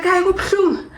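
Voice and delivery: high whining protest; whiny complaint. Retold or imitated. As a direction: whine that it hurts, half-joking. Her retold cry of pain.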